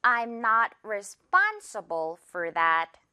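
I'm not responsible for that said very slowly, NOT and SPON stressed; very slow teacher model of the sentence; slow stress drill. Shown as a demonstration. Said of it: "one more time".

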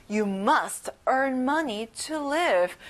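very slow, carefully spaced sentence; slow model sentence. Shown as a demonstration. Teacher modelling a refund request slowly. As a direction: say it slowly to be memorised. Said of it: "Next sentence".

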